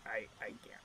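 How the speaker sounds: low, hushed, stammered refusal; frightened goblin under his breath; fearful refusal. Acted. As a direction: drop low and quiet, too scared to tell. Frightened goblin refuses to betray master.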